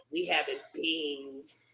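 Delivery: low, soft and slow, sly tease; low quiet taunt. Retold or imitated. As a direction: drop the voice low and drag it out. Retelling sister's taunt to grandpa.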